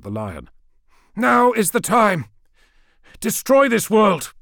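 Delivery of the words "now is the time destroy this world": high, loud battle order; strained, commanding shout; urgent command. A narrator's reading. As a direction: cry out the final order. Orders the Lion to destroy.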